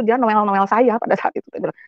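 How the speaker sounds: ordinary talk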